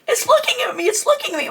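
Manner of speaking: high, frantic repeat; pleading in alarm, voice up high; panicked. Retold or imitated. On the phone to her husband, frantic and high. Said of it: "So I'm like".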